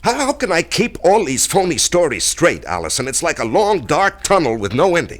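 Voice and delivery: loud, high, despairing cry over his lies; anguished outburst. Acted. Man pours out his despair to Allison, anguished and loud. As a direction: let the guilt burst out of him.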